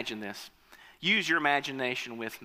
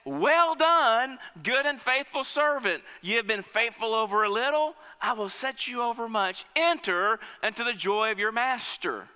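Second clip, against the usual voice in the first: raised, ringing praise from the king; master's praise; 6 semitones higher than usual. Acted. King praising the second servant, raised voice. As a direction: commend him like a pleased master. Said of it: "He says".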